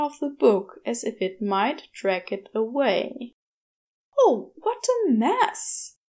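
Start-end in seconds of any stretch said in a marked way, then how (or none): 4.2-6.0 high, slow, flustered complaint; fussy dismay. A narrator's reading. Aziraphale fusses over spilled tea, flustered and slow. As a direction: fret over your soaked sweater. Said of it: "Aziraphale muttered".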